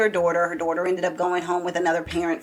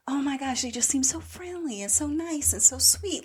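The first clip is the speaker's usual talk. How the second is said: sweet Minnesota voice; 5 semitones higher, 4 dB quieter than usual. Retold or imitated. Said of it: "the Minnesota accent is like".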